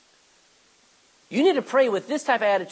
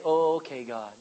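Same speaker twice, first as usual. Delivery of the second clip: low, softer, offhand prayer; flippant; 6 semitones lower, 4 dB quieter than usual. Acted. Someone praying without seeking God, flippant and offhand. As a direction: toss it off with no desperation. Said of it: "don't just flippantly say".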